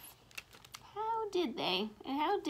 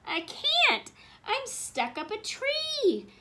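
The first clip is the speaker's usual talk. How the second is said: high, loud, drawn-out complaint; calling from above; 11 semitones higher, 6 dB louder, much slower than usual. A narrator's reading. Wilbur answers from up the tree, high slow holler. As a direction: call down from up a tree. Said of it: "Wilbur's voice said from above them".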